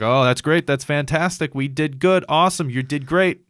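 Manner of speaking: loud, high gushing doctor; hammed-up congratulations from a wired surgeon; over-eager praise. Doctor praising patient after surgery, over-eager.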